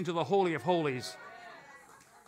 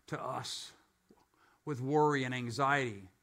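usual speaking voice

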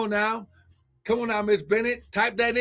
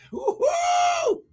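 ecstatic whoop. Real, not acted. Preacher overcome with praise mid-sermon, ecstatic high whoop. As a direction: whoop like the spirit just hit you.

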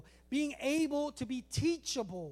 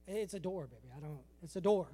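weary parent; 7 semitones lower, 4 dB quieter, faster than usual. Acted. Parent answering a kid.

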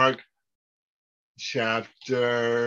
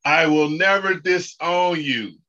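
louder, stressed "never"; emphatic lead line; 4 semitones higher, 4 dB louder than usual. Shown as a demonstration. Teacher leading students in repeating the vow, emphatic. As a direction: drive "never" home for them to echo. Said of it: "repeat after me".

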